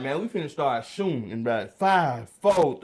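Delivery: loud, rising threat; loud countdown threat. Retold or imitated. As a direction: count it down menacingly. Guards counting down.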